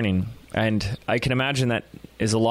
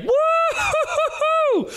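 put-on long, very high kid-like whoop; drawn-out high "woo-hoo" of sheer joy; gleeful whooping holler; much slower than usual. First-time kiteboarder flying across water, whooping like a kid. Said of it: "hooping and hollering like a little kid".

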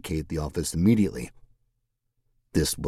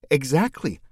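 put-on playful young woman; 8 semitones higher than usual.

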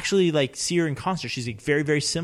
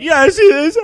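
mocking imitation; 12 semitones higher, 8 dB louder than usual. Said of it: "he's like".

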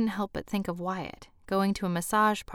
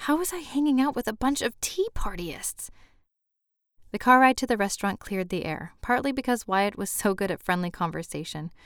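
ordinary delivery, nothing marked

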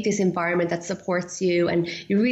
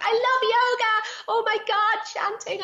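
much higher, bubbling over with delight; gushing excitement. Retold or imitated. Telling a friend about chanting class, gushing and much higher. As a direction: squeal it like a thrilled new convert. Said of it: "I was so freaking excited".

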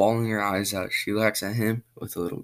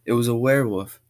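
loud, higher cry of alarm; yell; 3 semitones higher, 6 dB louder, faster than usual. A narrator's reading. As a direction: shout it out, scared kid. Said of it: "Jason s-, yells".

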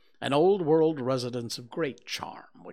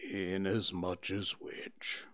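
low, slow, whispered and mournful; gloomy donkey Eeyore; 5 semitones lower, 7 dB quieter, slower than usual. Acted. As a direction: sigh it out like a sad old donkey.

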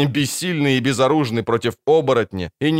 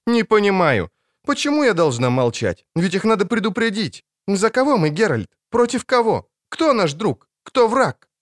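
high, heated questions; indignant protest; 9 semitones higher than usual. Acted. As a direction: press him for answers, upset. Ciri protests to Geralt.

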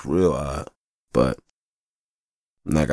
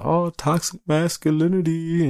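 mocking critics; 12 semitones higher, slower than usual.

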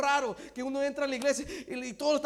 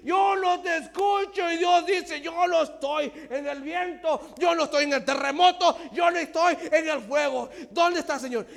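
loud, raised sermon shout; full-force preaching; 3 semitones higher, 4 dB louder than usual. Acted. Preacher to the congregation, preaching at full force. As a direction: drive the message home.

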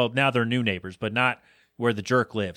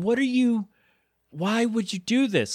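much higher, incredulous what are you; exasperated disbelief; 9 semitones higher than usual. Retold or imitated. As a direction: throw up your hands in disbelief. Ranting at the lost driver.

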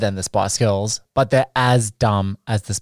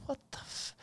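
whispered aside; 23 dB quieter than usual. Real, not acted. Muttered reaction to the review.

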